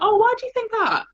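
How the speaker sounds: high, falsely curious question; mock innocent. Answering the lookalike comment, mock innocent. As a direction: play dumb sweetly. Feigned innocent comeback to colleagues.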